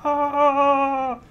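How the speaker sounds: imitating a desperate fan, ending in a yelp; mock desperate viewer. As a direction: play a frantic player begging for a date. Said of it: "shout on the forum".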